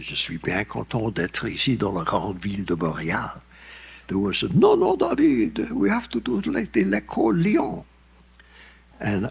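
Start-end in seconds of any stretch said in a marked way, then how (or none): 0.0-3.4 put-on plain American-accented French; French as an American visitor speaks it; his own French. Introducing pieces to the Montreal audience in American French. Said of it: "introduce the pieces and said". 4.5-7.8 a pitched-up corrective voice; correcting him. Retold or imitated. An orchestra member correcting David's French. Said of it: "correcting him".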